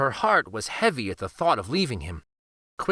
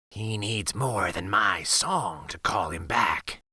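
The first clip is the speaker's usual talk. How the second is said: low, soft, distant wolf; 6 semitones lower, 4 dB quieter than usual. Acted. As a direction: speak as a calm old wolf, low.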